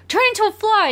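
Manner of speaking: high, excited cartoon plea; mock-excited high command; eager acted request. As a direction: pitch it up like an eager cartoon hero. Acting out Mickey's eager request.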